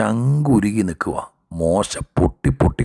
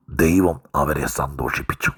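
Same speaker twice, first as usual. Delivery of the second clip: ordinary talk, nothing marked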